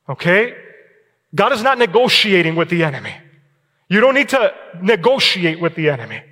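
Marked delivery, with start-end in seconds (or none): none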